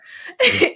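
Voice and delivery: high burst of laughter; bright laugh. Real, not acted. Teacher laughing about spotting pride, loud high laugh.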